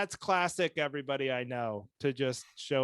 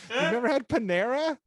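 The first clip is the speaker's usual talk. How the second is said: mock incredulous; 10 semitones higher, 7 dB louder than usual. Retelling buddy's quote.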